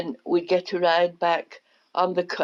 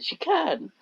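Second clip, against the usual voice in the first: retold child insisting; 12 semitones higher than usual.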